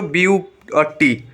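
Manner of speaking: "बी यू और टी" in two separate beats; split into syllables. Shown as a demonstration. Teacher shows students a two-syllable word, "बी यू और टी" split apart. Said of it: "डाई syllable word".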